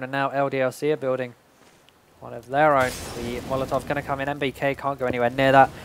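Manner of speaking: ordinary delivery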